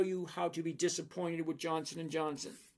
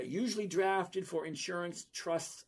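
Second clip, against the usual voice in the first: ordinary talk, nothing marked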